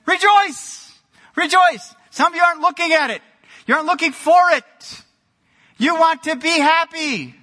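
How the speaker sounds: high, loud cry to rejoice; exuberant exhortation. Real, not acted. Preacher urging the congregation to rejoice, high and exuberant. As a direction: urge them on with high-pitched, emphatic force.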